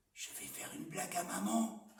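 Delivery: put-on conspiratorial child's whisper; whispered prank. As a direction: sly whisper. Jojo plotting a prank.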